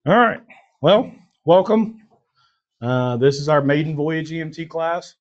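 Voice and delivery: high squeaky voice from a cold; chipmunk voice. Real, not acted. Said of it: "I sound like a freaking chipmunk right now".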